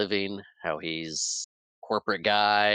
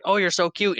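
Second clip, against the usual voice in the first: high, louder, cooing delight; gushing over kids; 10 semitones higher, 5 dB louder than usual. Retold or imitated. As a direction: gush over the children.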